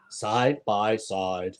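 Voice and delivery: low, slow, soft Forrest Gump drawl; lightly voiced Forrest Gump. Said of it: "We watched Forrest Gump last night".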